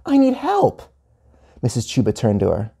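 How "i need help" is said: put-on very high child voice; high-pitched little-kid appeal; calling for help. A narrator's reading. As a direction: plead to the teacher in a high voice. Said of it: "Gia called".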